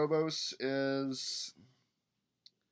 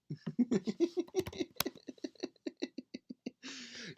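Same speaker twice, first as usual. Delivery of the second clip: long squealing laugh, much higher; real giggling fit; high burst of laughter; 13 semitones higher, 3 dB quieter than usual. Real, not acted. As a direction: break into a helpless high laugh. Delighted at a player's accent antics.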